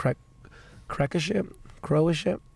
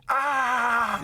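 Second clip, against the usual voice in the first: high scream-retching noise; mock scream throwing up; imitated sick scream; 8 semitones higher than usual. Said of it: "I was going".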